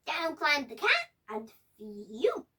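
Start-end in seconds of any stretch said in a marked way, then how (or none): 1.8-2.5 low relieved sigh of phew. Acted. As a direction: blow out relief. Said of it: "said the dog".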